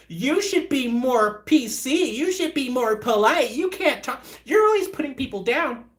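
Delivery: high-pitched mock scolding; high, drawn-out mocking of finger-waggers; mimicking scolds. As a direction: imitate whiny complainers, pitched well up. Mimicking people who scold him.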